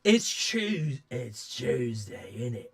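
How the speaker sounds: put-on grimy British old man voice; grimy old geezer. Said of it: "grimy old man geezer voice".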